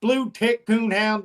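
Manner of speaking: a hint of a Southern country dad. Dad correcting his son, in a light Southern voice. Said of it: "so I give him that voice".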